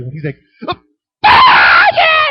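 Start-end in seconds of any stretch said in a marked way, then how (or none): 1.2-2.3 very high, loud, excited yell; imitated kid's joy. The picked boy cheering, high joyful yell. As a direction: shriek with delight like a thrilled child.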